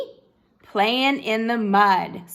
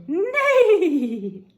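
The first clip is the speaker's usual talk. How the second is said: slow, drawn-out playful neigh; horse neigh; 8 semitones higher, 5 dB louder than usual. Shown as a demonstration. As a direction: stretch it out like a horse. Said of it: "They go".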